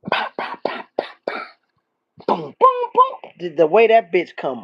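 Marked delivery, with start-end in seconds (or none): 0.1-3.3 percussive vocal beat, then high booms; mimicked beat. Showing how the song comes on, imitated beat noises. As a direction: sound out a song intro with your mouth. Imitates the song's intro sounds.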